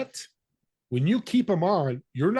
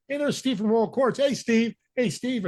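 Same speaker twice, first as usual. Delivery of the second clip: higher, cheerful greetings from people spotting a friend; friendly calling out; 8 semitones higher than usual. Retold or imitated. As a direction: hail him like you just spotted him across the restaurant. People greeting him at dinner.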